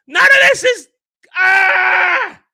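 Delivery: yelling in outrage at the set; full-throated angry yell; furious shout. Real, not acted. Nerd ranting at a Lego set, furious shout.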